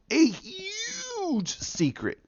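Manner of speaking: milk the mystery with a wailing hush; spooky hammed-up hush. The vlogger teasing viewers about the gods' secret, spooky slow hush.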